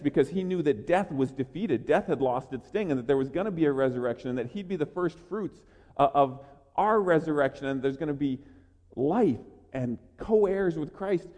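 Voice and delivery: usual speaking voice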